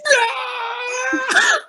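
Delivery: long high wail of pain; high scream. Retold or imitated. Stabbed gang member whining, high wail. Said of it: "he's just like".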